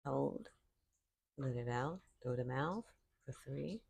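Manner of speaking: calm, unhurried voice with long silences between cues; guided meditation. Real, not acted. Yoga teacher leading listeners into breathing, calm guided meditation. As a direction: count the breaths softly, leaving room to breathe.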